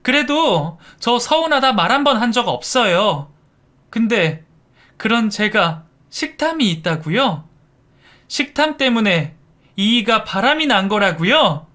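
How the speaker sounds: high, loud tearful outburst; crying shout. A narrator's reading. Daughter-in-law shouting through tears at her mother-in-law. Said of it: "하고 울면서 소리쳤죠".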